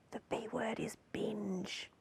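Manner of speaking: slow, hushed whisper; mock-secret whisper. News host leaking the banned word to viewers, mock-secret whisper.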